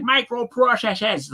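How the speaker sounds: high-pitched hammed-up movie Boston accent; mock Boston accent. Mocking how movie actors talk, Boston accent. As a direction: exaggerate a bad Boston accent. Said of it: "the way they talk".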